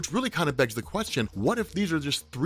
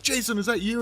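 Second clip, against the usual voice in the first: freaking out; 8 semitones higher than usual. Retold or imitated. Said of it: "Barbara Gordon freaking out".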